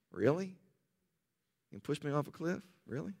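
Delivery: low, soft, laconic cowboy stare-down; Clint Eastwood, quiet and low. Retold or imitated. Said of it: "I picture more of a Clint Eastwood".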